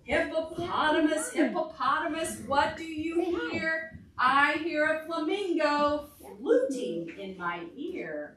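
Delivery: singsong refrain, a bit slow; storybook chant; reading to children. Acted. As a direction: chant the book's refrain for toddlers. Reads picture book to children.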